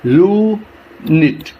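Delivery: "Unit" slowly in two separate parts; "unit" drawn out and broken at the syllable; split into syllables. Shown as a demonstration. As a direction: stretch the first syllable of "unit", pause, then the second. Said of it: "टू parts में divide कर देते हैं".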